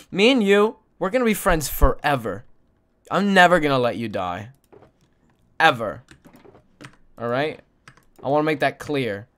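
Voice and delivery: ordinary delivery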